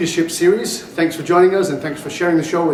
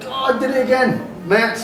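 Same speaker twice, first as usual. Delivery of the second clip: higher-pitched self-reproach; mock exasperation; 8 semitones higher than usual. Real, not acted. Host fumbles guest's name again on air, exasperated. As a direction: groan at your own slip, pitched up.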